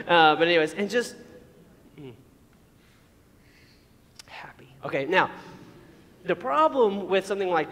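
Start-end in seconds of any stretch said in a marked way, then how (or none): 2.0-4.7 very soft, breath-only sigh of a word; hushed mock bliss; blissful whisper. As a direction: whisper it, savouring a sip. Savours drink, demonstrating liquid happy.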